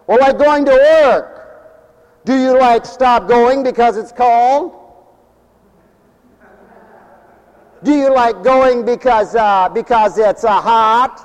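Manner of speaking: hammed-up Valley Girl voice; Valley Girl talk. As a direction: tease the congregation like a Valley Girl. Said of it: "that's the way they sound".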